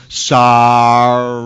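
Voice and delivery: low, sullen, dragged-out sorry; a forced apology with no remorse; grudging fake sorry again. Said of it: "He doesn't".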